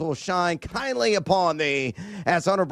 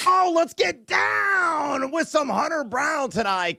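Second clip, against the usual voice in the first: high, slow, shouted hype; hyped pick; 10 semitones higher, slower than usual. Real, not acted. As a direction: sell the pick like a ring announcer. Hyping his best bet.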